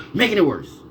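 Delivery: heated insistence. Real, not acted. Speaker ranting at those targeting them, heated insistence. Repeats point in heated rant.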